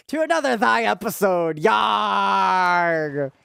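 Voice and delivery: mock pirate host voice; pirate accent. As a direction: open the episode like a cartoon pirate captain. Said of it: "I'll do a pirate accent".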